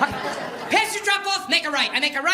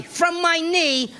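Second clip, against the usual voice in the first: usual speaking voice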